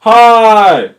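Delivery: high, loud holler; hammed-up shouted greeting; yelling. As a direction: yell it at the microphone. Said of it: "I have to yell".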